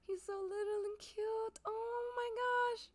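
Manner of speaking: very high, drawn-out squeaky gushing; cuteness squeal. Real, not acted. Player gushing over a tiny villager, squealing adoration.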